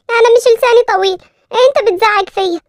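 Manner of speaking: put-on little girl talking back to a grumpy man; indignant little girl. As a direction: stand up to him like a stubborn kid. Narrator voices little girl.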